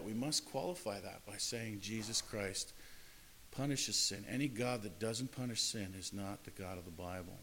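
usual speaking voice

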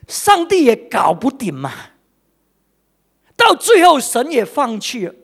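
loud, high impassioned preaching; pulpit-pounding sermon climax; fervent preaching. Acted. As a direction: drive it home with fire from the pulpit. Preaching at full force.

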